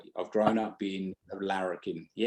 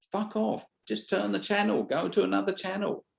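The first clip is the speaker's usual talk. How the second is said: exasperated outburst; 7 semitones higher than usual. Real, not acted. Telling offended viewers to leave.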